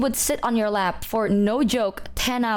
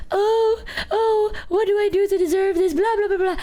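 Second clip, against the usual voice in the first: much higher, hammed-up weepy lament; mock crying wail; 11 semitones higher, 3 dB louder than usual. Retelling crying while hugging her dog, mock high wail. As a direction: wail it like a fake sob story. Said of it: "I'm, like, crying".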